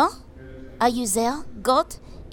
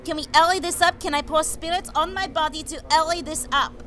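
put-on high, raised French-accented call; calling the guards; 6 semitones higher, 5 dB louder than usual. Joan asking guards for her execution, high insistent call.

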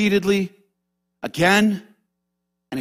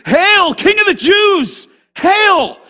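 loud, slow, mocking soldiers' shout; 11 semitones higher, 6 dB louder, slower than usual. Soldiers jeering at Jesus with a loud mocking shout. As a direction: jeer it out like a soldier mocking a king.

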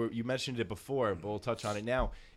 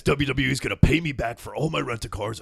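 wrestler promo; 2 semitones higher, 8 dB louder than usual. Retold or imitated. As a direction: ham up a wrestler threatening on the mic. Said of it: "The wrestlers are like".

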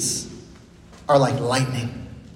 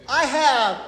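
high herald call leading the group; yelling together; 9 semitones higher than usual. Shown as a demonstration. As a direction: lead the yell, high. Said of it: "Let's do it all together".

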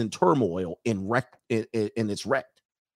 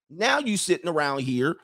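usual speaking voice

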